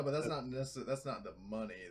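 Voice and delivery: usual speaking voice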